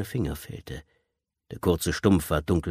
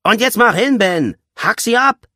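high, loud, aggressive order; furious ringleader driving a child on; shouted command; 10 dB louder than usual. A narrator's reading. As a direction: scream at him to get on with it. Leader screams orders at child.

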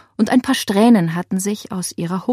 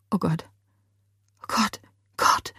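small, stunned, much softer voice; horrified; 6 dB quieter than usual. A narrator's reading. Laila after seeing the woman fall, faint with horror. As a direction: barely get the words out in shock.

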